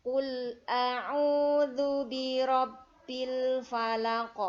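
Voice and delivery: slow, loud melodic chanting; Quran recitation. Shown as a demonstration. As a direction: chant it slowly like murottal. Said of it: "Kita mulai ayat pertama".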